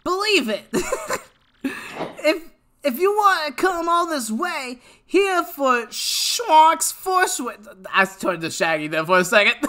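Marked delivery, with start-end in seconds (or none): 0.0-7.4 high-pitched cartoon hero reading dialogue; Naruto. Streamer reading a character's challenge to newcomers, high Naruto impression. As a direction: keep up a high, put-on anime character voice. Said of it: "I gotta do my Naruto voice".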